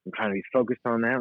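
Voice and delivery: normal talking voice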